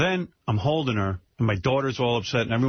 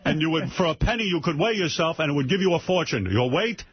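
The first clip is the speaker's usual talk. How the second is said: higher, a lightly voiced lecturing father; 7 semitones higher than usual. Daughter voicing her father's fortune rules, higher. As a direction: lay down the law like an old dad. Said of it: "he says".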